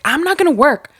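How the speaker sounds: high, loud imitation of a snappish coworker; mock testy refusal. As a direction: blurt it back defensively, mimicking him.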